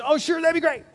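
high, gushing people-pleaser yes; put-on enthusiasm to please; mock eager agreement. Acts out people-pleasing automatic yes.

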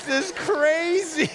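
high, drawn-out disbelief; amazed exclamation. Retold or imitated. Pastor reacting to the intern's surprise car, amazed. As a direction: stretch it out in high-pitched amazement.

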